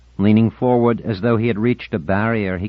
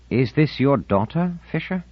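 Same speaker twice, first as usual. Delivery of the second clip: soft incredulous; 8 semitones higher than usual. A narrator's reading. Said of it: "in a soft, incredulous voice".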